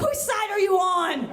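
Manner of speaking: loud, high, drawn-out yell; indignant mock shout. Narrator driving a smoking car to onlookers, indignant mock shout. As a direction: holler back as if betrayed. Driver yells back at street.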